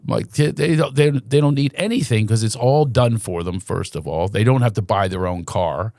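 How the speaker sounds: normal talking voice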